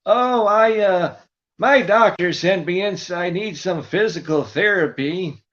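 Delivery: put-on high, slow dim old caller; Buddy voice. Prank caller pestering the front office, high and slow. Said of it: "I would call up the clinic".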